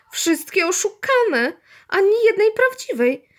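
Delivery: high, drawn-out indignant whine; hammed-up upset boy; dismayed. A narrator's reading. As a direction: wail in exasperated disappointment. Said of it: "Guciowi wcale się to wszystko nie podobało".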